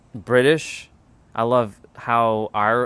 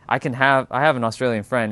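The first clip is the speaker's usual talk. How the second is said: normal talking voice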